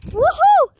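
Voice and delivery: high, loud whoop; excited whoop. Real, not acted. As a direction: cheer it out mid-flip. Cheering while flipping.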